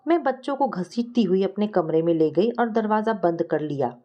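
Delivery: ordinary delivery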